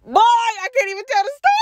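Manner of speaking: high, overwhelmed cry of disbelief; emotional outburst. Real, not acted. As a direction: blurt it out, too shaken to go on. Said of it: "I'm getting emotional".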